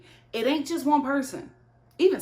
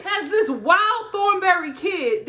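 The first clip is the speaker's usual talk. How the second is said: usual speaking voice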